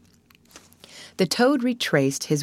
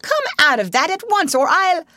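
high, indignant outburst building louder; very angry; 8 semitones higher, 3 dB louder than usual. A narrator's reading. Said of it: "said Toad very angrily".

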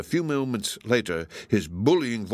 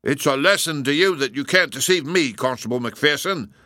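self-important, measured reproof; dignified; 5 semitones higher, 4 dB louder than usual. Acted. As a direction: lecture him with dignity. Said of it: "said Lestrade with dignity".

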